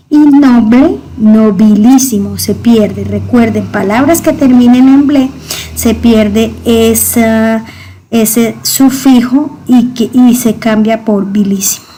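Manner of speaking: usual speaking voice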